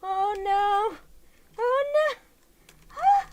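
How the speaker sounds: high, loud panicked cries; panicked yelps. Real, not acted. Losing grip on the doll's elastic, high loud panic. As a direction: yelp as it slips away.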